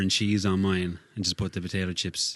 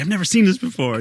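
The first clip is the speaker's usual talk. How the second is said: high, loud amazed doctor; 11 semitones higher, 7 dB louder than usual. Retold or imitated. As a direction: play the stunned doctor. Doctor voiced in a story.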